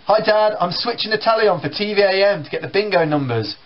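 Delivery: breezy hello to dad; cheery teenager. Retold or imitated. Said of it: "I sit down".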